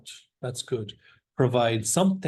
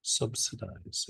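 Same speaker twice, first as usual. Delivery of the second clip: Subsidize said slowly and softly, syllable by syllable; slow, softer Subsidize with each syllable clear; broken into syllables; 2 semitones lower, 9 dB quieter, slower than usual. Shown as a demonstration. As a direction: break Subsidize up as sub-si-dize. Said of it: "Just break it up".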